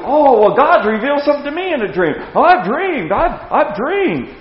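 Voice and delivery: lightly lampoon the self-important prophet; preacher mimicking lying prophets, mock boastful; mocking false prophet. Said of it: "that's why these people".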